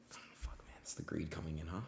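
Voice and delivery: unvoiced muttered regret; whispered aside. Real, not acted. Streamer whispers to himself about his greed. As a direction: breathe it to yourself. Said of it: "whispered self talk".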